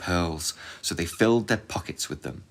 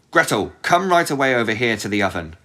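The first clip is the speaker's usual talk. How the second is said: loud, higher witch call; 5 semitones higher, 8 dB louder than usual. Acted. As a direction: summon her across the room. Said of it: "the old woman called".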